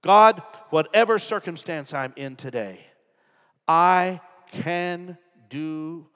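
loud, slow prayer line; earnest prayer. Acted. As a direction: pray it with conviction. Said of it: "what you need to whisper".

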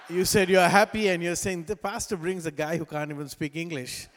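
ordinary talk

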